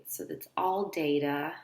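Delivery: ordinary talk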